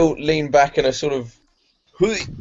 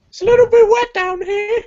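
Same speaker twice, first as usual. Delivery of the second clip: very high, squeaky character voice; 4 dB louder than usual. Acted. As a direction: squeak it up from down the well. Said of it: "he has a squeaky voice".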